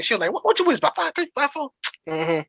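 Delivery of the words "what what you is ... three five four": mock yell. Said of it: "she's yelling across the thing".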